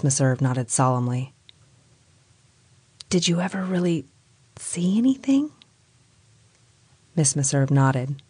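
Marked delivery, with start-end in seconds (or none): 3.1-5.5 hushed, rising, scared question; fearful whisper. A narrator's reading. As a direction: whisper it half afraid to know. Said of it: "she whispered fearfully".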